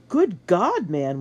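loud, high outburst falling away; shocked exclamation. A narrator's reading. Soldier seeing the wounded master, loud shocked exclamation. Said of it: "one of the soldiers exclaimed".

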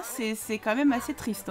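normal talking voice

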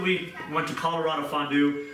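normal talking voice

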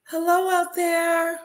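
high, loud sing-song hello; a high, drawn-out hello to the room; sing-song greeting. Real, not acted. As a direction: call out a bright welcome to the viewers. Cheery greeting opening a livestream.

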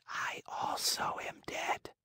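faint, breathless whisper, slow at the end; barely audible, as if already gone. Acted. Azure-haired maiden whispers to Pinocchio from the window, faint whisper. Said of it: "she whispered".

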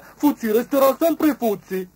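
high, loud beetle voice; beetle's voice. A narrator's reading. Beetle certain about Fuzzi, high and loud. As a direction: play the beetle in a distinct high voice. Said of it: "pensò Scarabeo".

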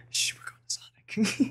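hushed secretive whisper. Retold or imitated. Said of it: "you're like".

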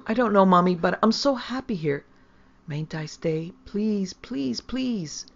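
put-on high, pleading child, loud at first; hysterically excited. A narrator's reading. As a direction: beg with mounting desperation. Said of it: "so hysterically excited".

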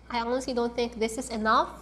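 usual speaking voice

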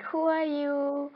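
small, high-pitched challenging fish voice; little guy. Acted. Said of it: "this little guy was like".

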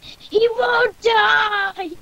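long frustrated cry, acted out; retold exasperated yell. Gamer retelling a first boss fight, exasperated yell. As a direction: re-enact a drawn-out, high, loud wail. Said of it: "I was like".

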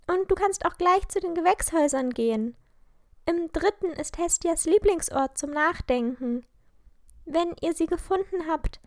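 high voice, organising friends; girl taking charge. A narrator's reading. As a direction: hand out search tasks briskly in a high voice. Narrator voices Lilly giving orders.

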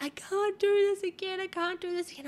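high, far-off panicked wail, imitated; screaming and crying. Frightened woman at the back of the store, panicked high wail. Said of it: "she was screaming and crying".

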